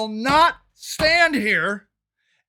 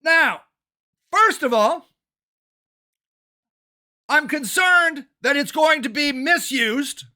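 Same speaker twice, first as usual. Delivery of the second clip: ordinary delivery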